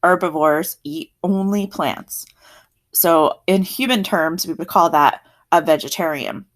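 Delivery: ordinary delivery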